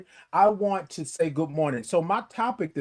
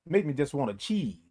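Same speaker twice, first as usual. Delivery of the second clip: usual speaking voice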